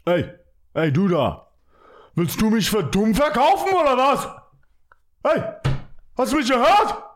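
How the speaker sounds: high, indignant mock-German tirade; yelling in German. Host confronting an imagined German, comic German yelling. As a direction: yell it like an outraged German. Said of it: "I yell in German".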